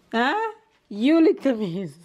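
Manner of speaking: ordinary talk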